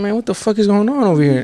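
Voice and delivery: retold bewilderment, pitched high; alarmed disbelief. Teen questioning a strange area at night, baffled.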